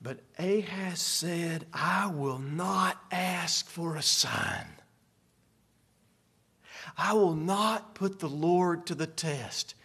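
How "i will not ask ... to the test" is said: slow, low and soft, sanctimonious; measured, hushed show of piety; pious refusal. Acted. King piously declining God's offer, hypocritically hushed.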